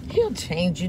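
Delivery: ordinary delivery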